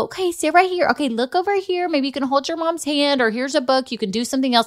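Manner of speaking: sweet. Retold or imitated. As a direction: imitate a kindly nurse distracting a child. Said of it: "they were gonna be so sweet".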